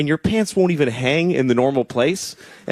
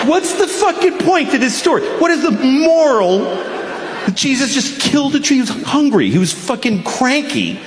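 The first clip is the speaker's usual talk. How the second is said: shouting; 10 semitones higher than usual. Real, not acted. Comic ranting at the audience about the story, shouting. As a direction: bellow it at the crowd in disbelief.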